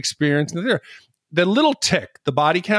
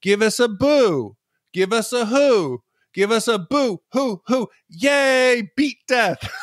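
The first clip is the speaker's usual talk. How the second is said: higher, slow chanting cheer; mock funeral cheer; 6 semitones higher, slower than usual. Host recalling mourners cheering at a funeral, mock chant. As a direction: chant it like a pep squad. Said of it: "they're like".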